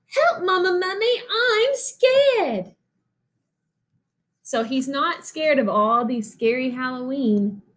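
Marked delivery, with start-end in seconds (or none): 0.1-2.8 much higher, squeaky mock fear; mock frightened cry. Baby mummy squeals for his mother in hammed-up fear of the mouse. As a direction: squeal for mama, hammed-up scared.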